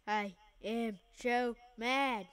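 ordinary talk, nothing marked

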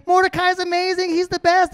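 high, cheering praise; crowd cheering. Pretend. The crowd cheers Mordecai through the city, high and cheering. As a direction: cheer him on like an adoring crowd. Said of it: "everyone's cheering".

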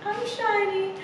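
high, soft preening snake voice; self-admiring snake. A narrator's reading. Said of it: "And Snake is like".

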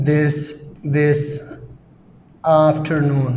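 each word said slowly and repeated; slow dictation. Shown as a demonstration. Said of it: "teacher dictates word by word". (إملاء بطيء كلمة كلمة)

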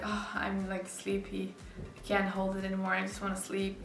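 ordinary talk, nothing marked

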